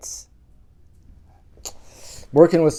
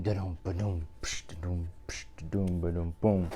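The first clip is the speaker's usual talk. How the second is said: quiet mouth drumbeat; beatbox the drums softly; soft vocal drumming; 6 semitones lower, 11 dB quieter than usual. Acted. Reader drums the refrain quietly for the kids.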